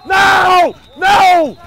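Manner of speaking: loud, sharp no; alarmed shout. Real, not acted. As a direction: stop the player with a yell. Coach reacting to bad play.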